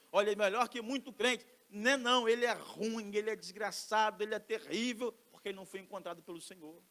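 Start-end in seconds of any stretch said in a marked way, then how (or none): 0.1-5.1 emphatic retort. Acted. Preacher voicing the objecting believer.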